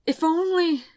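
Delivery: loud, high, breaking off; anguished outburst. A narrator's reading. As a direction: burst out in grief then stop. Grief breaking through.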